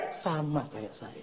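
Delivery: ordinary delivery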